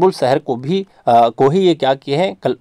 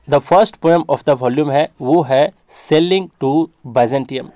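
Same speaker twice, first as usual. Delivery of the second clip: very slow, word by word for notes; slow dictation; much slower than usual. Shown as a demonstration. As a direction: spell it out slowly so students can write. Teacher dictating key notes. (धीमे-धीमे notes लिखवाना)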